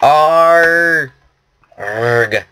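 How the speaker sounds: lightly voiced, badly faked pirate growl; a drawn-out, half-hearted pirate "arg". Gamer trying a pirate voice alone, admittedly terrible pirate. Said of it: "That was the worst pirate".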